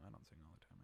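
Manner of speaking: soft mumbled admission; sheepish retraction. Real, not acted. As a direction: take it back quietly, embarrassed. Quiet correction after joking claim.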